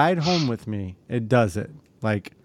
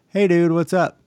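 higher and louder, a fake-bright hello; imitating himself calling the shop too cheerfully; mock cheery greeting; 6 semitones higher, 5 dB louder than usual. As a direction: play it overly friendly on the phone. Voicing his own call to the shop.

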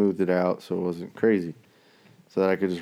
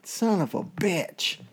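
high, slow, drawn-out exasperation; mock disbelief; 10 semitones higher, slower than usual. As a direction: ham up the surprised curse. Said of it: "I'm like".

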